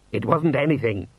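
plain reading voice

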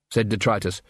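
plain reading voice

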